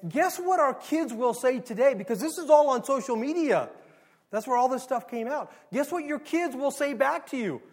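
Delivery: heated challenge. Real, not acted. Pastor rising to a point.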